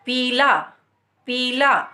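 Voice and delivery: पीला with the ई held long; पीला with a clear, drawn-out ई. Shown as a demonstration. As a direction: stretch the ई in पीला. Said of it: "ई का उच्चारण साफ स्पष्ट सुनाई देता है क्योंकि समय ज़्यादा".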